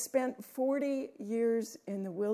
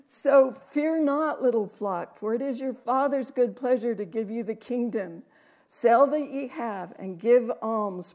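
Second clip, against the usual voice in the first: solemn, resonant reading of the psalm; scripture reading; 6 dB louder than usual. Acted. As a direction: read the psalm out, full and solemn. Reads Luke twelve aloud.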